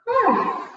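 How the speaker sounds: high, falling whew; short high exhaled whew; exertion whew. Real, not acted. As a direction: let out a high puff of relief. Exertion in a yoga stretch.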